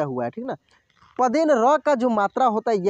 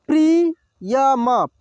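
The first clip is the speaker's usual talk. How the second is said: Priyam drawn out slowly, syllable by syllable; slow sounding out; 2 semitones higher, 4 dB louder, much slower than usual. Shown as a demonstration. Teacher slowly sounds out Priyam for students. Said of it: "एक एक मात्रा को अलग अलग करके".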